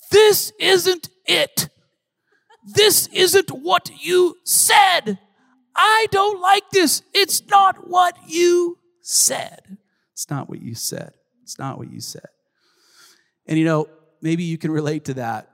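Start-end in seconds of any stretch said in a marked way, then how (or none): 0.1-7.0 imitating a protesting little kid, high, loud and slow; upset child. 7.1-9.8 high, slow, loud mock child's wail; upset child. Imitating his upset child protesting. 10.1-12.3 put-on soft, lower echo of the child's protest; subdued repeat. Same protest repeated, dropping away.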